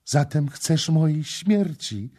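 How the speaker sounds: high, loud alarmed question; frightened question. A narrator's reading. Kama asking the prince in terror, high and alarmed. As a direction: recoil in terror as you ask. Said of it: "odparła przerażona".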